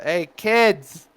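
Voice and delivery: loud, high holler with drawn-out words; yelling out. Acted. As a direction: yell across the bar at the kids. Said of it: "he just yells out".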